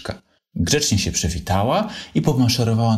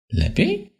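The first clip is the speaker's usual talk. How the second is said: little fox asking. A narrator's reading. Franio asks doubtfully.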